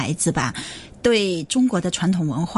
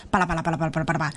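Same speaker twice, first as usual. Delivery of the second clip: fast, softer gabbling through the words; mock rattling off; 3 semitones lower, 4 dB quieter, much faster than usual. Shown as a demonstration. Teacher mocking a meaningless fast recital. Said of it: "那是没意义的".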